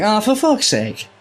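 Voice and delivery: high, slow Irish exasperated swear; imitating a frustrated Irish player; Irish accent. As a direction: curse the dice in an Irish lilt. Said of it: "did you go Irish for that".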